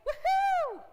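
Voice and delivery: high, loud whoop of joy; exaggerated celebratory whoop; mock joyful cheer. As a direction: burst out in a hammed-up cheer. Mock joyful reaction, contrasted with fear.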